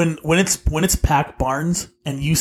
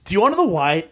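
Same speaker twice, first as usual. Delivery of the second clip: ordinary delivery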